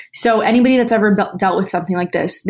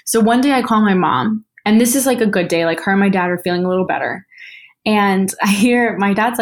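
usual speaking voice

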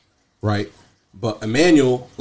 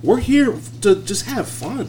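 exasperated plea; 10 semitones higher than usual. Pretend. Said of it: "I'd be like".

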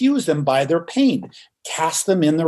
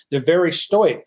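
normal talking voice

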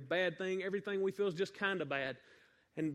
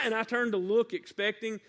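ordinary talk, nothing marked

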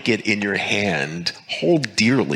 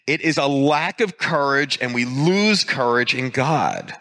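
ordinary talk

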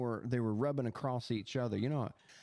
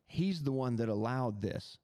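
ordinary talk

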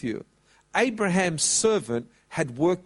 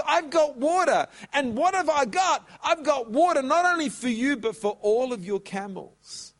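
higher and eager, showing off; proud answer; 7 semitones higher than usual. Acted. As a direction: announce it like a winning offer. Rebecca's triumphant answer acted.

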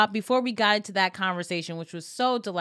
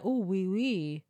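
mock French accent; 3 semitones lower, 5 dB quieter than usual. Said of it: "I was like".

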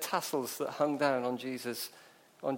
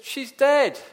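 high, loud blurted objection; crowd's protest; 11 semitones higher, 12 dB louder than usual. Acted. Mourners answering Jesus, loud protest. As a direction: exclaim it, insisting on the obvious.

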